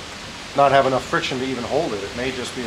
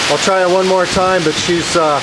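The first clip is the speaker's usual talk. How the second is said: talking loudly over storm wind; raised voice to carry outside; 6 semitones higher, 6 dB louder than usual. Real, not acted.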